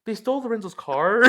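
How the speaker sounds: mock surprised question. Girlfriend asking on the phone, high incredulous question. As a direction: imitate her puzzled asking.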